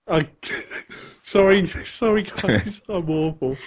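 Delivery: embarrassed giggle breaking into the words; laughing apology. Real, not acted. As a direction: apologise through a real laugh. Laughs at own failed accent.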